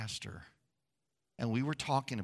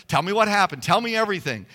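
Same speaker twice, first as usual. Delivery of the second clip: louder, higher, eager questioning; eager Naomi; 5 semitones higher, 9 dB louder, faster than usual. Retold or imitated. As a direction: beg for every detail. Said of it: "She wants to know all about it".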